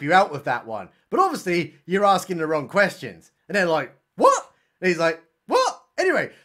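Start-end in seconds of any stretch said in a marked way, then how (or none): 4.2-4.5 very high, loud, short yelp; mock bafflement. Coppers thrown by his nonsense answer, mock bafflement. Retold baffled reaction of policemen. 5.5-5.8 very high, short questioning squeak; mock bafflement. Creepy man echoing the baffled coppers, mock bafflement. Retold baffled echo back at policemen.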